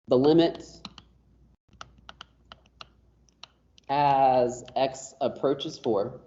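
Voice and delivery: slow read-aloud with long pauses between phrases; reading notation aloud. Shown as a demonstration. Teacher turning math symbols into a sentence for the class, slow read-aloud. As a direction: read it out piece by piece, very slowly. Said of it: "I read this out loud as".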